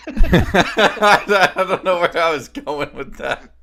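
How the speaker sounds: loud, slow, laugh-filled words; laughing through words. Real, not acted. Friend laughs at her failed Texan joke, laughing loudly.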